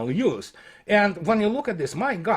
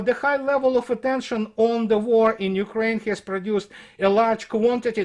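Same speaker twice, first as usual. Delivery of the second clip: reading out a report, slightly raised; report read aloud; 5 semitones higher than usual. Acted. Commentator reads critics' report to his audience, formal read-aloud.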